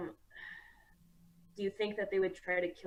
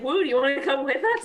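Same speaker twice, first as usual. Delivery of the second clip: eager call; 7 semitones higher, 9 dB louder, faster than usual. Acted. Player voices Tara inviting Wu.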